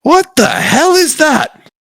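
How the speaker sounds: high, slow alarmed shout; shocked guard crying out; startled guards. Acted. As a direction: recoil at the strange beast. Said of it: "they're like".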